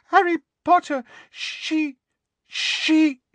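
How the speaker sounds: very high, squeaky, halting elf; squeaky house elf. Acted. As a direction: stammer in a frightened elf squeak. Dobby voiced, afraid.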